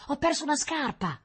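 put-on high-pitched little girl's alarm; dismayed exclamation. A narrator's reading. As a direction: blurt out the loss in dismay. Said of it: "esclamò a un tratto Rita". (esclamazione acuta e sgomenta)

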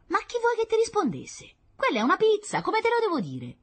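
put-on very high child voice; exasperated little girl. Sister insisting it is a pizza, exasperated. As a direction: argue back in a high, impatient voice.

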